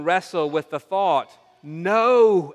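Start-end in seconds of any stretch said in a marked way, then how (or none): 1.6-2.5 loud, stretched "No!"; emphatic refusal. Acted. Believer wrestling with living sacrificially, emphatic refusal. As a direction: punch out a long, firm refusal. Preacher voices the inner refusal.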